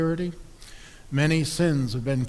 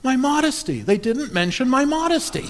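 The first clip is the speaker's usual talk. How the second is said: much higher, louder squeal of hurt pride; wounded vanity; 12 semitones higher, 5 dB louder than usual. Acted. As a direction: whine it like a vain old pastor. Dying pastor's punchline.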